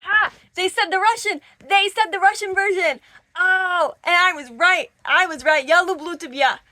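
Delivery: high, loud, triumphant outburst; excited shouting. Real, not acted. Reader finding the Russian version in her book, excited shouting. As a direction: shout it out, thrilled to be proved right.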